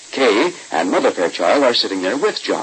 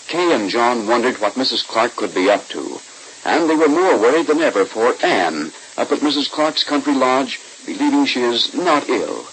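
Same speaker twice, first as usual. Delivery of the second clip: ordinary talk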